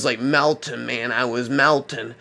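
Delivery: usual speaking voice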